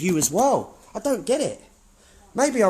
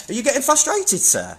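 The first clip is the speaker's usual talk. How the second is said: taunting; 6 semitones higher than usual. Real, not acted. Taunting a staff member.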